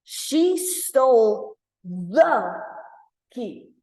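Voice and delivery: "the" drawn out and loud, then a pause; stress on the. Shown as a demonstration. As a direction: stretch "the" as if only one exists. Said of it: "what if I say this?".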